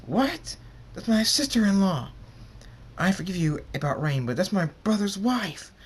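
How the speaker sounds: high, loud protest; shocked outrage. Acted. Brother objects to love for his sister-in-law, outraged. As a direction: cry out in disbelief, pitch jumping up.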